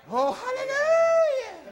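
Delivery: long, drawn-out, high hallelujah cry; sustained high praise shout; ecstatic hallelujah. Real, not acted. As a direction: lift it up high and hold it, slow. Preacher's ecstatic exclamation.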